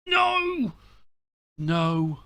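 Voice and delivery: high anguished cry of no; despairing shout. Real, not acted. Substitute news, despairing outburst.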